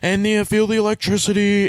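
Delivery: lightly voiced dreamy mentor; mock mentor. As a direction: coax it with a hint of a slow mentor voice. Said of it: "And he's like".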